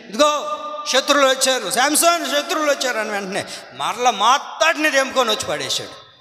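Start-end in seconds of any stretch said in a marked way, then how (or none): 0.1-3.0 shouted alarm. Acted. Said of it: "అరిచింది".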